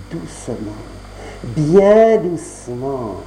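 très lent, à voix retenue; étire chaque mot, tout bas puis lentement; tout doucement. A narrator's reading. Conte qui mime la douceur. (conteur qui mime le geste discret, très lentement)

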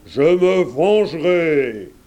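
burned wolf howling his vow; yelled threat. A narrator's reading. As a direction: shout the threat as you run. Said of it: "en hurlant".

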